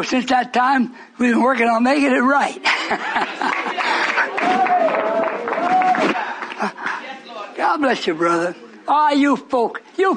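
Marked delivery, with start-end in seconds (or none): 2.7-7.5 long high burst of laughter; delighted high laugh. Real, not acted. Preacher laughs.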